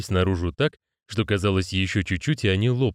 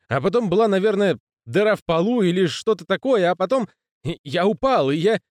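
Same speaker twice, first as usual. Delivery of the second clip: put-on rattled young man; 9 semitones higher than usual. Moist's voice, rattled.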